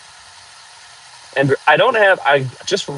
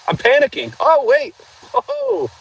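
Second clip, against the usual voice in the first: panicky; 10 semitones higher than usual. Real, not acted. Fumbling with poll on stream.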